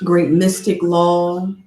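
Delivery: normal talking voice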